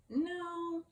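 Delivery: high playful no; mock surprise. Real, not acted. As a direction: pretend the word sounds wrong. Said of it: "wrong sound".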